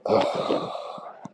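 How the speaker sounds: long unvoiced sigh; deflated sigh into the phone; heavy weary sigh. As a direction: let out a tired sigh.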